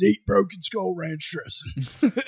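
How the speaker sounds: slow Texas growl; Stone Cold quote. Retold or imitated. Podcaster quoting Stone Cold's catchphrase in Texas drawl. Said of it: "Austin three sixteen says".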